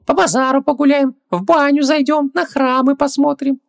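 very high little djinn; squeaky creature voice, keen for an outing; Хубаксис, eager. Acted. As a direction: chatter in a tiny high voice.

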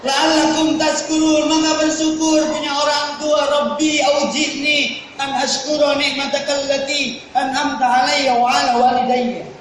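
high, loud Quranic chant; Quran recitation. Acted. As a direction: intone the prayer like a qari. Quran verse and prayer recited.

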